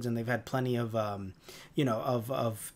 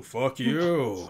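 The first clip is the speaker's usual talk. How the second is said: lower, put-on villain retort; Mondo; 3 semitones lower than usual. Mondo returning Grumble's insult, put-on lower voice. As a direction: answer back in a drawn-out character voice. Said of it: "Mondo's like".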